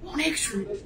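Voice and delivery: a soft, quiet imitation of Nahid saying it; imitating Nahid's sweet praise. Host imitates Nahid praising the dress, soft and sweet. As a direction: say it softly and sweetly, like Nahid does. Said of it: "nightie কি সুন্দর করে বলে".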